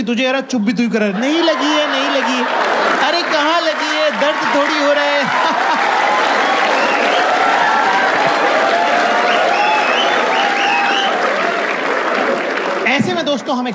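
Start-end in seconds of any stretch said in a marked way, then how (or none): 1.2-5.3 higher, pained brushing off; mock brave denial. Bleeding boyfriend comically insists he is not hurt. 5.3-12.8 long squealing laugh; high cackling laugh. Real, not acted. Comic cracks up at his own story.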